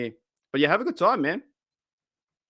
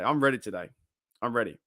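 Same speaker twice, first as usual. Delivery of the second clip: ordinary talk, nothing marked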